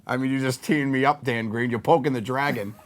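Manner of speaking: ordinary delivery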